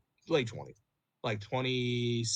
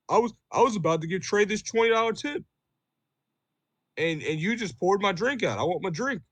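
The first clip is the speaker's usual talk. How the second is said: higher, louder whiny complaint of an offended customer; mocking angry customer; 8 semitones higher, 5 dB louder than usual. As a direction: play the aggrieved customer with exaggerated indignation. Angry customer complaining about tip.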